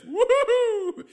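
long, high, slow nervous whoop. Acted. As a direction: blow off the panic with a high woo-hoo.

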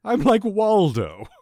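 loud, high, drawn-out clown boast; high, showy villain voice; Joker. Pretend. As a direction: ham it up as a cartoon villain, slowly. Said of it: "I'm the Joker".

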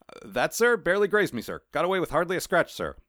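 cat fibbing to Death; nervous cat. Acted. Cat voiced answering Death.

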